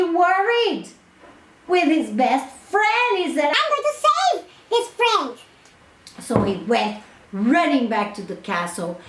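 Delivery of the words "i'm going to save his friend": very high, quick determined voice; a tiny hero vowing; brave little hero. Acted. Isumboshi vows to save the princess, high and fast.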